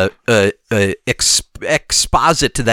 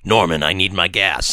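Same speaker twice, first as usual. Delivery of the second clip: low, softer mock villain demand; Kingpin voice; 9 semitones lower, 3 dB quieter than usual. Kingpin calling Norman on the video phone, low mock menace. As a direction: drop down into a growly boss voice. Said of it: "and he's like".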